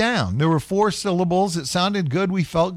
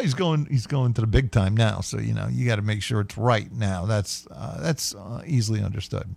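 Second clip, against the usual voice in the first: ordinary delivery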